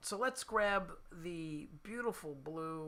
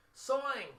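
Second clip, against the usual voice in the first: higher, louder "Sawing" for soaring, New Jersey style; New Jersey accent; 7 semitones higher, 5 dB louder than usual. Shown as a demonstration. Musician announcing tune with running joke, New Jersey accent. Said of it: "it's the New Jersey accent".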